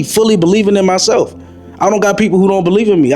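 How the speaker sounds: ordinary talk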